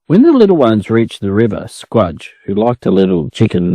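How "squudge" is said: ordinary delivery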